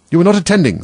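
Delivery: loud, high-pitched scolding; stern telling-off, high and loud; severe. A narrator's reading. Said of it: "said the mouse to Alice severely".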